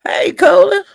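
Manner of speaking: high, loud, excited hello to a new viewer; excited call-out. Real, not acted. Greeting a viewer who just joined the live, loud and high. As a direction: sing out the name in greeting.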